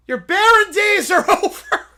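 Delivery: high, loud, slow line broken by laughter; laughing. Real, not acted. Reading his own cringey line aloud, laughing.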